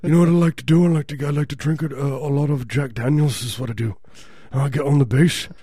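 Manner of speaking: hammed-up rocker boasting of his habits; a little too articulate rocker impression. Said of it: "I do a spot on [name]".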